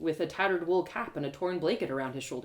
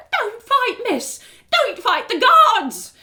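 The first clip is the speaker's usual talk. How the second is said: put-on much higher, louder frantic old beggar; frantic pleading; 12 dB louder, much slower than usual. Beggar woman drags narrator back, frantic. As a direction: beg her to stop, panicked.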